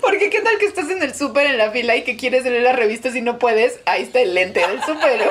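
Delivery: giggling through the words; laughing. Real, not acted. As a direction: talk while laughing. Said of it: "no te rías".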